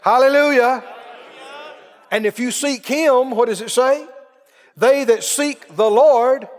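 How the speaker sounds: loud rising sermon cadence, slowing at the end; fiery preaching. Acted. Preacher exhorting his church, full-force preaching. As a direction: build to a shouted scripture.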